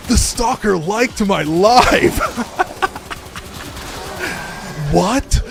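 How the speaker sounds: thrilled fan squeal; giddy disbelief. Real, not acted. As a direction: stretch it out in delighted shock. Stalker interacts with stream.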